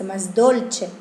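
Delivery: usual speaking voice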